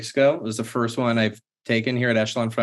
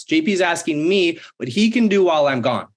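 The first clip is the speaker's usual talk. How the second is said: amazed emphasis; 6 semitones higher than usual. Real, not acted. Stressing boss's surprising offer.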